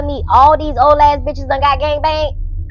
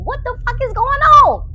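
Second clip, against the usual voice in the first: high-pitched baffled exclamation, acted out; mock confused outcry; 11 semitones higher than usual. Tanisha bewildered by the nurse, mock confused outcry. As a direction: shoot the question way up in bewilderment. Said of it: "She confused".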